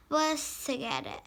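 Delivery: ordinary delivery, nothing marked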